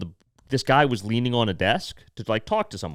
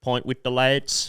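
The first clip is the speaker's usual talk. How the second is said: slow, drawn-out British geezer; imitated British catchphrase; mock British accent; slower than usual. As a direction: put on a broad British accent. Quoting the British video guy.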